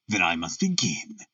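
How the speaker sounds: put-on subdued, deep German-accented voice. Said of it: "harsh voice with a strongly marked German accent".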